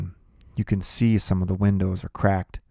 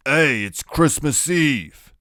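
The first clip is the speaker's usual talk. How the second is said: higher, slow, nervous pleading; 7 semitones higher, 5 dB louder, much slower than usual. Acted. Iggy nervously excusing the drinking to Kenny. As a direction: nervously laugh it off. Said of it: "he sounds real nervous".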